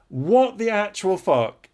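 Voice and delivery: high, slow, drawn-out indignant outburst; outraged disbelief. Real, not acted. Reacting to the cheating confession, slow and outraged. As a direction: let the disbelief stretch every word.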